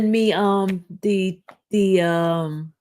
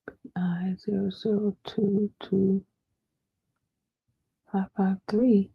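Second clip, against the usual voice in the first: whispering; 8 dB quieter than usual. Real, not acted. Reading case number under breath.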